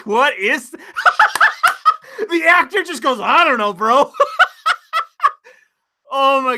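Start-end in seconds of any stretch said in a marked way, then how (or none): 0.8-2.0 high, loud burst of laughter; shrieking laugh. Real, not acted. Laughs at the actor. 2.3-4.1 high, giggling through the words; laughing. Real, not acted. Laughing while quoting actor. 4.2-5.3 shrill burst of laughter; high-pitched laugh. Real, not acted. Laughs again.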